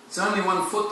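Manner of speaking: put-on boy in the dark, mildly tearful; son cries. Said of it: "the son cries".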